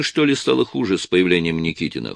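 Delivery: ordinary delivery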